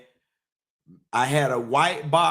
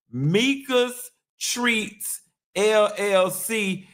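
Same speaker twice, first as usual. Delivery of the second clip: ad read; 3 semitones higher, much slower than usual. Acted. Slow ad plug.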